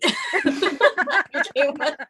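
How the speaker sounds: laughing through the punchline; giggling out the last words. Real, not acted. Artist laughing at her own spite story.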